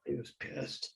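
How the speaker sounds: hushed aside; whispered. Shown as a demonstration. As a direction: lean in and whisper it. Repeated for effect.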